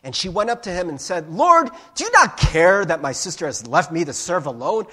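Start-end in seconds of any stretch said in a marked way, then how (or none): 1.3-4.9 loud, exasperated complaint; yelling at Jesus. Acted. Martha complaining to Jesus about her sister, acted yelling. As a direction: protest loudly, feeling left to do it all. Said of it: "I read it in that tone".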